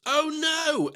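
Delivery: high-pitched exaggerated dismay; mock dismay. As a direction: let out a cartoonish token lament. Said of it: "my brain, like, goes".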